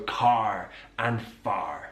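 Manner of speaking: "car" and "far" with a rolled-in R, slowly; slow rhotic "car and far". Shown as a demonstration. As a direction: say "car and far" sounding the R, West Country style. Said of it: "that R is pronounced".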